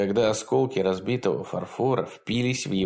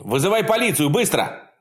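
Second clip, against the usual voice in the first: high urgent yell; 8 semitones higher, 4 dB louder than usual. A narrator's reading. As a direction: scream the order. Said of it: "завопил он".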